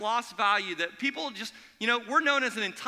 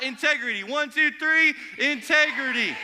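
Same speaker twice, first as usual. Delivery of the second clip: loud, high count-off into the yell; yelled response; 5 semitones higher, 6 dB louder than usual. Shown as a demonstration. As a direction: lead the crowd to shout Integrity. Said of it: "Yell out".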